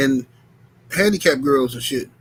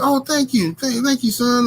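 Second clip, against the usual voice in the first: put-on sweet old lady; 8 semitones higher than usual. Voicing grateful church lady.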